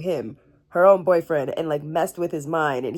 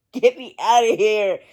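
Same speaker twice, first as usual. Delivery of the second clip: ordinary delivery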